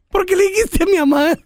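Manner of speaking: high-pitched imitation of a child's question; voz de su hijo. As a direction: mimic your little son asking Dad. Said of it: "mi hijo y me dice". (voz aguda de niño)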